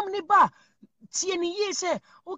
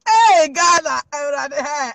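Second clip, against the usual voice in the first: loud call; 8 dB louder than usual. Acted.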